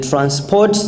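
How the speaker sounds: normal talking voice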